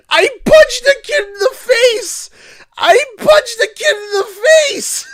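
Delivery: very high, squealing yell; loud, hysterical squeal; incredulous shout. Retold or imitated. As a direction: shriek the punchline in disbelief, close to laughing. Incredulous punchline, cracking up.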